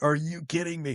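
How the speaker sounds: high-pitched starstruck disbelief; giddy, incredulous fan; retold disbelief. Retold disbelief at seeing stunt performer.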